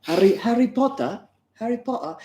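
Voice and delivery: high, put-on British accent. Shown as a demonstration. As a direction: try out the name in a mock British accent. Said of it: "speak in a British accent".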